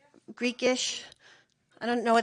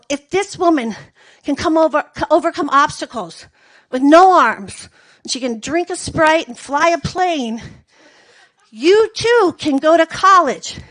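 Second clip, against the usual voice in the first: mock inspirational pitch; 3 semitones higher, 7 dB louder than usual.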